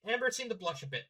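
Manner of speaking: ordinary delivery, nothing marked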